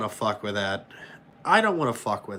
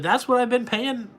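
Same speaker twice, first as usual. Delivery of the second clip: smug reply; 8 semitones higher than usual. Retold or imitated. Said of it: "I said".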